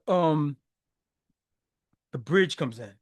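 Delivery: normal talking voice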